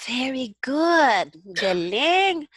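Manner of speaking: drawn-out, high-pitched congratulations; impressed praise. Real, not acted. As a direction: cheer the student on with delight. Teacher praising student's reading.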